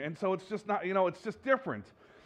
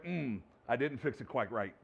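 low, soft inner grumble, much quieter; muttering to himself; 7 semitones lower, 7 dB quieter than usual. Retold or imitated. Pastor grumbling at a broken screen, soft mutter. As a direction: mutter it under your breath. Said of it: "I was like".

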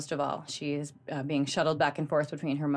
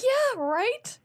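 much higher, eager yes; eager agreement. Retold or imitated. Agreeing with a friend who also cried, in a high eager voice. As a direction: jump in, thrilled someone agrees. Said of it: "I was like".

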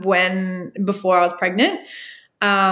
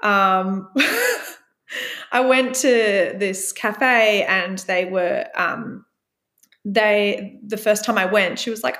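normal talking voice

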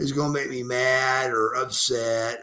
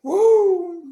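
woo; 7 dB louder than usual. Retold or imitated. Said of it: "and go".

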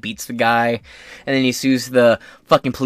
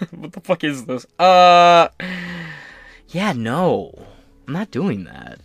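imitating unimpressed guy; slower than usual. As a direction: play a mildly baffled guy. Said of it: "A guy would be like".

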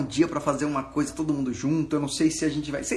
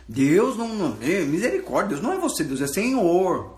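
higher, louder mock indignation; imitated scandalized objector; 4 semitones higher, 5 dB louder than usual. Preacher imitating people offended by "você", scandalized and emphatic. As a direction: protest in shock, stretching the last word. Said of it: "Tem gente que até fala assim".

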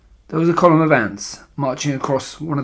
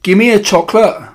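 high, loud bratty demand; insistent boy's yell; shouting boy; 7 semitones higher, 7 dB louder than usual. A narrator's reading. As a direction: shout like a spoilt child. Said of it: "shouted Bruno".

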